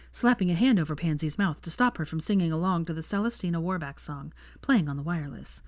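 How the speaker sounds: usual speaking voice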